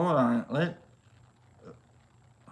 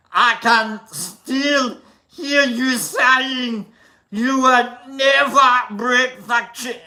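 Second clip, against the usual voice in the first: loud, higher Arnold Schwarzenegger singing; 6 semitones higher, 6 dB louder, slower than usual. Pretend. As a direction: sing it like Arnold, pumped up. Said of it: "We got Arnold".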